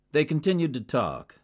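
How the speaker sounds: ordinary delivery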